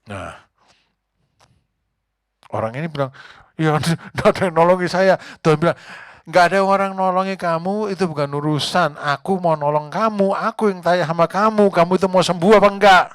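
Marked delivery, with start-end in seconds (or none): 3.6-5.2 laughing complaint. Retold or imitated. 6.2-13.0 loud, higher and insistent, pressing the question; God demanding an answer, raised voice; loud preacher playing God, insisting; booming God's voice.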